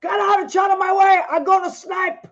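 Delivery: high, shouting put-on voice of a fed-up old Italian mother; imitating her mother. Quoting her mother about to snap at everyone, high mock shout. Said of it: "as my mother would say".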